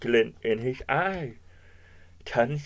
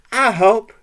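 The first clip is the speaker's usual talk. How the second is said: higher, loud eager offer; translated dog speech; 8 semitones higher, 11 dB louder than usual. A narrator's reading. Dog offers help with the chase, loud and high. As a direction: pipe up loudly as a helpful dog. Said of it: "He meant".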